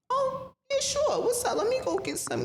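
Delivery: high, bright and eager; way up high, jumping at the offer; mock eager yes. To him on the phone, a high eager yes. Eagerly accepting his invite.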